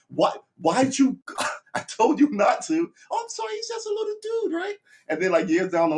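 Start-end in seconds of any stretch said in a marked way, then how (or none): 0.1-2.9 high, loud mock scolding; exasperated parent. Parents scolding the uncle for riling their kid, high and loud. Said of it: "They're always like". 3.1-4.8 very high, sheepish put-on voice; mock innocent apology. Uncle excusing himself to the parents, very high and sheepish.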